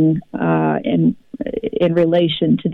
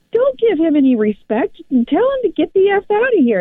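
mock insistent husband; 11 semitones higher than usual. Husband urges telling entity off.

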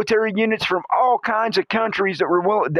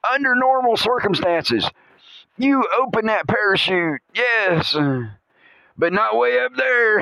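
usual speaking voice

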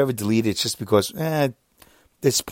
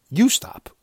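put-on childish retort; 10 semitones higher than usual. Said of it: "Now we're like twelve-year-olds".

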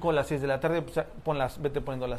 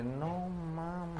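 susurro atónito; 12 dB quieter than usual. Retold or imitated. Todos impactados por el golpe.

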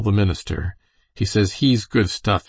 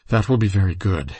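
ordinary delivery

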